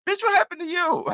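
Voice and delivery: very high-pitched, imitated shock; best friend's reaction. Best friend seeing the swollen eye on FaceTime, high squealed reaction. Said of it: "Here he go".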